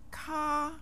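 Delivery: Ka held high and level; first tone. Shown as a demonstration. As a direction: draw Ka out on an even high pitch. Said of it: "The first tone".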